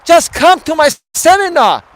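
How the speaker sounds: put-on high, loud accented seminar pitchman; hammed-up guru boasting to viewers, high-pitched; Tom Vu. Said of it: "And he would say".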